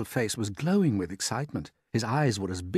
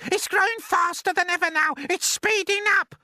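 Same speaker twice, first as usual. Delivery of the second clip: a far higher, louder aunt's shout; Aunt Spiker shouting; 7 dB louder than usual. Acted. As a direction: screech it out as the nasty aunt. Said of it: "Aunt Spiker shouted".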